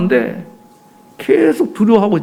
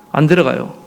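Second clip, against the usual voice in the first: ordinary talk, nothing marked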